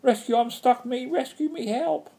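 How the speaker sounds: high-pitched frantic cries for rescue; stranded witch shrilly calling for help; shouting for help. A narrator's reading. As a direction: call out desperately, way up high. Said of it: "waving her arms and shouting".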